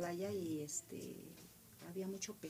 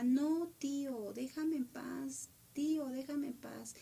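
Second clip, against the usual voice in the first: higher, slow pleading cry; retold pleading scream; 6 semitones higher, 4 dB louder, slower than usual. Girl begging her uncle to leave her alone, high slow plea. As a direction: beg the uncle to let go. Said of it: "ella gritaba".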